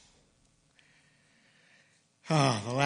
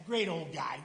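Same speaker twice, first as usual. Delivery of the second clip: usual speaking voice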